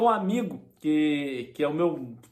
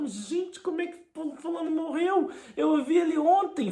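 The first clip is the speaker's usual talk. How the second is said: high, hushed shocked gasp of a mother; mother's gossip voice; 9 semitones higher than usual. Retold or imitated. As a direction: play the gossiping mother, scandalised. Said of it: "que minha mãe fala". (voz de mãe fofoqueira chocada)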